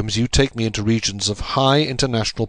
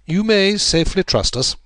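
higher, calm assurance; Holmes, reassuring; 10 semitones higher, 3 dB louder than usual. A narrator's reading. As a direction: promise discretion. Narrator voicing Holmes.

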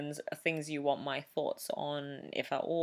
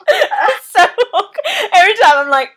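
high loud giggling speech; laughing; 20 dB louder than usual. Real, not acted. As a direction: laugh through the words. Laughing through awkward intro.

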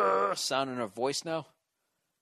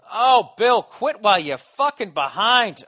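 mock heckle; 8 semitones higher, 13 dB louder than usual. Heckling himself in another voice.

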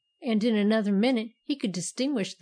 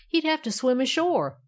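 ordinary delivery, nothing marked

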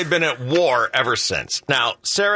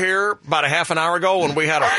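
ordinary delivery

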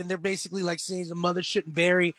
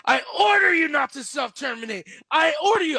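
commanding shout, higher and louder; John Connor ordering; 7 semitones higher, 4 dB louder than usual. Retold or imitated. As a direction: yell the order like a desperate kid. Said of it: "And John Connor's like".

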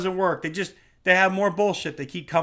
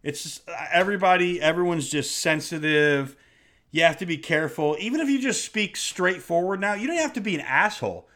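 usual speaking voice

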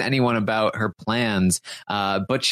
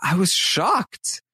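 mock dramatic shock; 6 semitones higher, much slower than usual. Marissa quoted, exaggerated.

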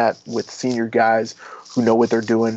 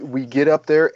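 normal talking voice

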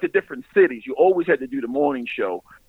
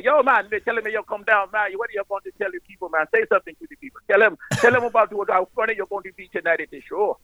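higher-pitched, lilting fake Jamaican accent; mock Jamaican accent; 6 semitones higher than usual. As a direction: hype up the crowd in a wobbly Jamaican impression. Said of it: "Man, you Jamaican and Indian".

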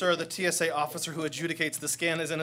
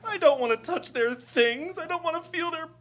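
whimpering; 10 semitones higher than usual. Acted. Officer whimpering.

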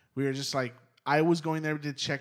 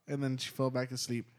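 ordinary talk, nothing marked

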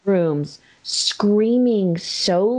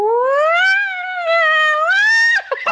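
long, high mock wail; imitated toddler wail; 4 dB louder than usual. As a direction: imitate a screaming toddler, one held high note. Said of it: "it was like this".

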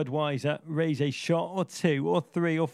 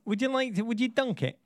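high mock voice; 7 semitones higher than usual.

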